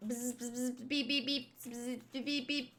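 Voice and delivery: quiet buzz and beep; robot beeping. Acted. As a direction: hum softly like a scanner. Imitating machine scanning.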